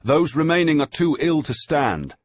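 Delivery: loud, high voices against the wind; shouting over the gale. A narrator's reading. Surgeon and master arguing on deck in a gale, shouting. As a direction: keep yelling to be heard in the storm.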